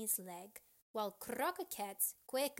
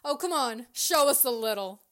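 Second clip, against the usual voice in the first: loud, raised-pitch coaxing; urging; 3 semitones higher, 14 dB louder than usual. A narrator's reading. Friend urging another to show some dancing, loud coaxing. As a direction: egg a shy friend on, loudly. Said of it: "she urged".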